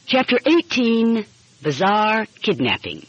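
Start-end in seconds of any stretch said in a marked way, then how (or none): none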